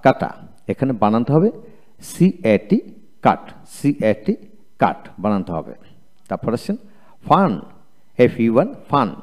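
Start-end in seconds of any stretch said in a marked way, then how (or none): none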